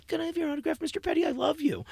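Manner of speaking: put-on high, soft little-kid voice; thirteen-year-old shyly asking his hero, high and small; timid young fan. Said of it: "Imagine little dumpy Mark in a tuxedo".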